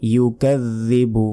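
ইউকাযযিবু slow, with a doubled ذ made with the tongue between the teeth; careful tajweed ইউকাযযিবু; tongue-out zal. Shown as a demonstration. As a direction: sound out ইউকাযযিবু slowly for students. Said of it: "জিহবা বের করে উচ্চারণ".

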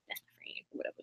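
barely audible whisper; whispered aside. Real, not acted. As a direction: drop to a tiny unvoiced murmur. Downplays her gushing in an aside.

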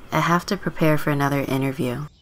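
normal talking voice

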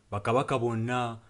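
ordinary talk, nothing marked

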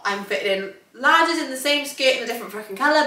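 ordinary talk, nothing marked

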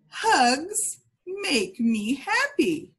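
slow, clear, cheerful model; slow model phrase. Shown as a demonstration. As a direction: say it slowly for kids to copy.

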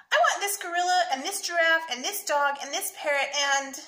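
put-on high, loud little boy listing wants; demanding child. A narrator's reading. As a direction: pile up demands like an eager kid. Greedy child demanding toys in story.

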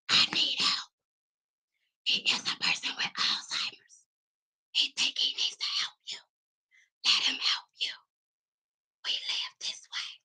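hushed whisper behind dad's back; secret whisper. Retold or imitated. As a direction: whisper so dad can't hear. Whispering aside to the lady.

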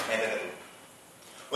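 slow, very soft whisper to God; whispered plea. Retold or imitated. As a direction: breathe it out slowly as a private prayer.

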